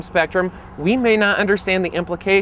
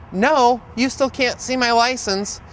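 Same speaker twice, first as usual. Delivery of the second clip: breathy laugh, then higher and slow; 7 semitones higher, slower than usual. Retold or imitated. Driver refuses the officer, laughing, higher and slow. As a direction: laugh it off like a trick question. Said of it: "he laughed when he said".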